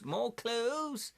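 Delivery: high, rising exclamation; excited high-pitched cheer. Real, not acted. Excited repeat over new clues.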